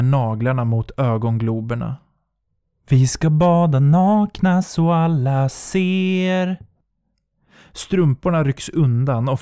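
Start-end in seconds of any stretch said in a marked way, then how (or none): 2.9-6.6 slow, distant eerie lilt; high slow phantom voice; ghostly beckoning. Acted. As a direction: coax her toward the water.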